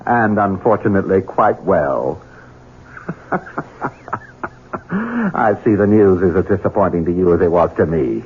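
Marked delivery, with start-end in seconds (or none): 3.0-4.2 soft gloating laugh. Acted. Villain gloats over mother's fate.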